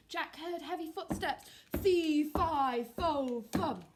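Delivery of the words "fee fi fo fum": loud, booming giant chant; big giant voice, much louder. Acted. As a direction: boom it out like a huge giant. Giant announcing himself.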